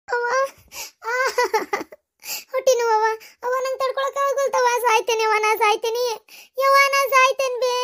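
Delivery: high-pitched wailing in pain; agonised labour cries. Acted. Daughter in labour crying to mother, agonised. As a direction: wail as if you can't survive it.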